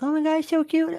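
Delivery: very high, squealy cooing voice; gushing over a baby. Retold or imitated. Friend mimics people's immediate reaction to babies, high gushing coo. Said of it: "a immediate reaction".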